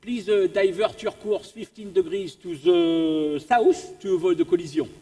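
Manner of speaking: put-on polite radio request in a French accent; French-accented radio operator, measured and courteous. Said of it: "I'll do the French accent".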